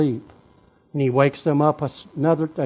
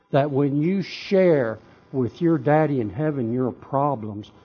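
ordinary delivery

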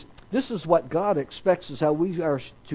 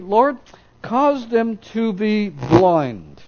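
1 fervent slow prayer; 5 semitones higher, 5 dB louder, slower than usual. Acted. Elisha prays against the enemy.

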